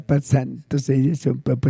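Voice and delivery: normal talking voice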